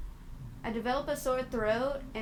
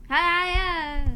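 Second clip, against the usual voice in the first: loud stretched hiya, a bit higher; cheery shout; 5 semitones higher, 10 dB louder than usual. Acted. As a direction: belt the greeting out. Cheery greeting to stream chat.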